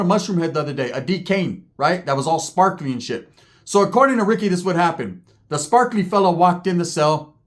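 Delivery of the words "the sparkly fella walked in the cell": normal talking voice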